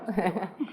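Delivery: short real chuckle; light chuckle. Real, not acted. Host thanking the speaker for a useful talk, light chuckle. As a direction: let out a brief, easy laugh.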